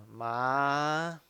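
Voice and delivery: 麻 drawn out and rising; second tone. Shown as a demonstration. Teacher recalling the practiced second tone, 麻 rising. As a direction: slide 麻 slowly upward. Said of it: "二声是".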